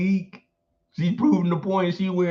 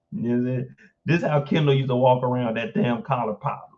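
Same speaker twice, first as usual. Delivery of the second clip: ordinary delivery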